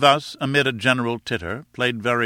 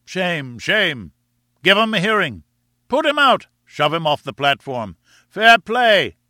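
high, loud volley of heckles; crowd uproar; 6 semitones higher, 5 dB louder than usual. A narrator's reading. Divided audience shouting over the professors, in uproar. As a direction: call out like rival voices in a rowdy hall. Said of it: "emerged from a general roar of amusement or execration".

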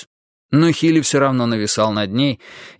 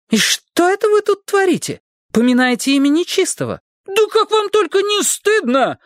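much higher, scandalised scolding; a housekeeper shaming the children; yelling maid. A narrator's reading. Housekeeper yells at the children, much higher and scandalised. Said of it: "завопила она".

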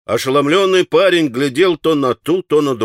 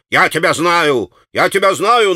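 harping on it like a fool; stubborn, raised and annoyed; dumb repetition; 5 semitones higher, 3 dB louder than usual. A narrator's reading. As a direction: clerk repeating himself foolishly. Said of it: "наладил, как дурак".

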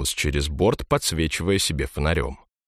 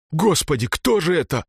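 frightened gasp; 10 semitones higher than usual. A narrator's reading. Frightened exclamation.

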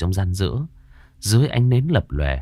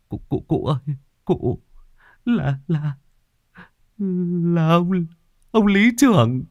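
terrified stammer; 10 semitones higher than usual. A narrator's reading. Servant Sử finds the body and stammers in terror, rising high. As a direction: panic climbing with each broken word. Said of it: "Anh Sử run run".

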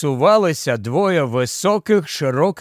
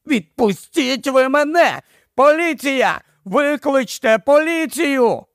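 high, frantic pleading shouts; desperate shouting; 9 semitones higher than usual. A narrator's reading. Ed pulling free of the crowd, shouting for police.

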